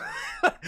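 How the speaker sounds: burst of laughter. Real, not acted.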